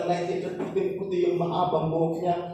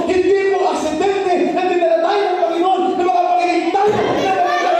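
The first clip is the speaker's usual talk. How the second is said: high, loud sung-out preaching; fervent preaching; 10 semitones higher, 6 dB louder than usual. Acted. Pastor exhorts the church members, fervent preaching. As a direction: preach with rising fervour.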